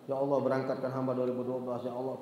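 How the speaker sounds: quiet, pleading prayer; hushed morning prayer; soft prayer. Retold or imitated. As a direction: pray it softly and low. Daily prayer retold.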